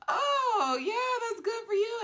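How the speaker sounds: high, forced-cheerful congratulations; mock delight that hides envy; fake happy. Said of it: "So she's just like".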